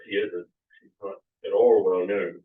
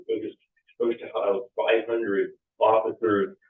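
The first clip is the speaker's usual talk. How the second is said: ordinary talk, nothing marked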